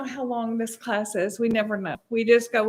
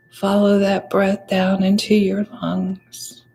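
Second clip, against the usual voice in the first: guided meditation; 4 dB louder than usual. Real, not acted. Teacher guides the class to follow the breath, guided meditation voice.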